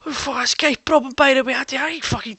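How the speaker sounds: high, loud Glaswegian rant; Glaswegian accent. Retold or imitated. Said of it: "guy from Glasgow".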